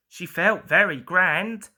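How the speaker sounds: ordinary delivery, nothing marked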